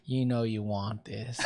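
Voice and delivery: smug slow come-on; cocky flirting. Retold or imitated. Flirting back with the cashier, slow, low and smug. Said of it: "And I'm like".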